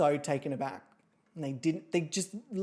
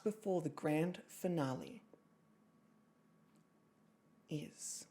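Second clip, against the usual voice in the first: serious; 2 semitones lower, 5 dB quieter than usual. Acted. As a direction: announce it gravely with long suspenseful pauses. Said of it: "I have to do it with serious".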